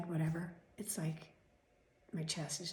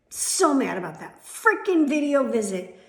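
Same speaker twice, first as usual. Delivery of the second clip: loud, forceful venting; stretched words, loud and raised, fuming; real angry rant; 4 semitones higher, 15 dB louder, slower than usual. Real, not acted. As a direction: blast it out in real anger. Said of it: "You don't know how mad I am".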